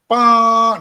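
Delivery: long loud held ba; loud sustained ba; wrong sustained note. Shown as a demonstration. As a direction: hold it like a pedal tone. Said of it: "It doesn't go".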